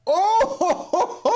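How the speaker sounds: high "oh-ho-ho" laugh trailing off; real laughing howl. Real, not acted. Host cracks up at the impact, high laughing howl.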